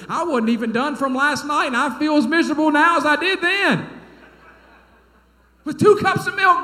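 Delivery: high, loud comic complaint; exasperated outburst. Real, not acted. Preacher confessing his overeating to the congregation, exasperated outburst. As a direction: wail it loudly at a high pitch.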